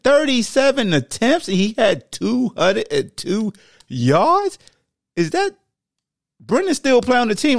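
can't believe these numbers, slowing on the yards; stunned, mocking disbelief; incredulous. Real, not acted. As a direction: pitch jumps high in disbelief. Disbelief at passing stats.

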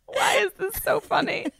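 asked through helpless laughter; crying with laughter, voice squeaking high; laughing. Real, not acted. Both in fits of laughter.

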